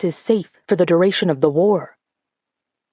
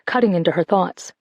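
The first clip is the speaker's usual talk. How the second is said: ordinary delivery, nothing marked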